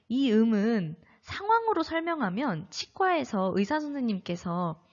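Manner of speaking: ordinary talk, nothing marked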